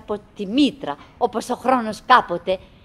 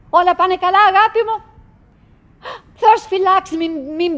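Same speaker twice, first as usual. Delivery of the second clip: higher, louder worried call across the distance; anxious call; 9 semitones higher, 5 dB louder than usual. Acted. Wife calls out to her unseen husband, anxious and loud. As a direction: call out to him, fearing he's hurt.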